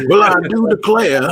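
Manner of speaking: southern belle. Pretend. Joking answer to how he sounded.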